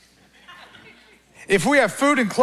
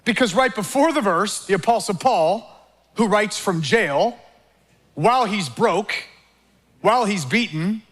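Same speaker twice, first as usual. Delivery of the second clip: ordinary talk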